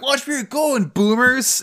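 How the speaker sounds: high mocking yell at passing drivers; yelled insult. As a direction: shout it out the car window. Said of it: "Beecha and Elle are like".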